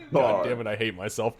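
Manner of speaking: ordinary delivery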